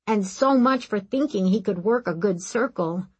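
usual speaking voice

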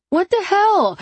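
high, loud startled yelp. A narrator's reading. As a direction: yelp it out in shock. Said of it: "I yelp".

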